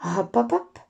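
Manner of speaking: plain reading voice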